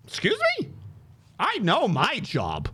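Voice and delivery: high, incredulous squeak of disbelief; indignant excuse me. Retold or imitated. To the angry evaluator, shocked and high-pitched. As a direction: act stunned at the accusation.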